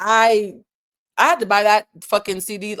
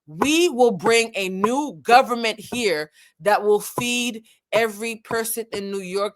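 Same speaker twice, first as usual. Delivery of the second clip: slow, deliberate re-quote with weight on EVERY; emphatic repeat; 2 semitones higher, slower than usual. Retold or imitated. Host re-reads [name]'s promise to the audience, slow and emphatic. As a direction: repeat it slowly, landing each word.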